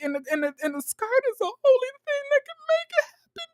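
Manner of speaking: high-pitched tearful rant, imitated; Irena. Imitating her explaining her mama's advice, tearful and high. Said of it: "Irena crying gets me weak every time".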